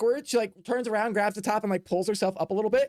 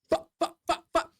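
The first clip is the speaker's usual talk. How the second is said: panicked swearing; 9 semitones higher than usual. Acted. Player panicking at daggers.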